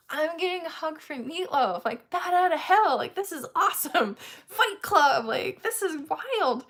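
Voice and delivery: high, giddy gushing; retold starstruck excitement. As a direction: gush as if you can't believe it. Reliving starstruck excitement at the hug.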